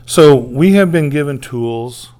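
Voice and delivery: loud, slow, every word pressed; emphatic preaching. Real, not acted. Preacher stressing God's gifts to the church, loud and slow. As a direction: land it hard on the congregation.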